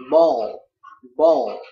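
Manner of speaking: ball said loud and drawn out; loud pronunciation demo. Shown as a demonstration. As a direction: punch ball loudly as a model word. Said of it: "hay là".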